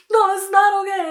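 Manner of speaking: high, upset mock wail; upset and tearful. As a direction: act out a crying boy's protest. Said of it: "he started crying".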